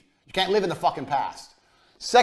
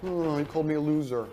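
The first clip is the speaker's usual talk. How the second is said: mock hurt whine; 5 dB quieter than usual. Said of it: "before one of you guys start crying".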